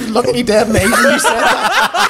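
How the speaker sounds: cracking up mid-sentence, barely getting words out; squeaky laughing disbelief. Real, not acted. Cracking up at co-host's remark.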